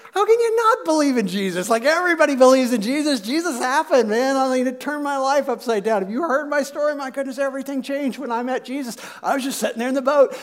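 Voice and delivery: high, animated exasperated disbelief; worked-up, astonished protest; acted incredulity. Peter incredulous at weak belief.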